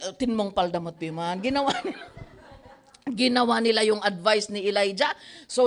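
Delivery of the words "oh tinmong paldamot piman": normal talking voice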